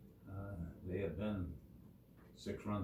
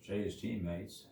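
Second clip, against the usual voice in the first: ordinary delivery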